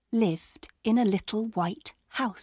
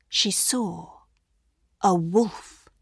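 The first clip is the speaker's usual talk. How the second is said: ordinary delivery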